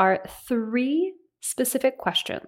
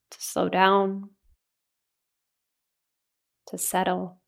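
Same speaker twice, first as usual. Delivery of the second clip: ordinary delivery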